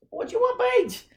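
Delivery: very high pet-talk voice; talking to the cat. Real, not acted. Teacher asks his cat Paige what she wants, high pet coo.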